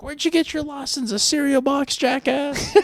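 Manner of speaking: high mocking pedestrian yell; road rage yelling. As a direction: shout it like an angry passerby. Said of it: "I still say that when I'm driving".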